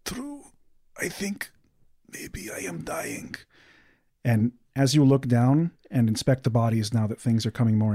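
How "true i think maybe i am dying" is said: faint, high, fading to a whisper; slow breath of a dying man. Acted. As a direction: let the voice give out into a whisper. Dying uncle's last words.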